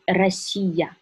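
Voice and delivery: "Россия" with a trilled first R; Russian Россия with tongue-tip R; rolled R. Shown as a demonstration. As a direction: say "Россия" slowly, rolling the Р. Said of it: "For example".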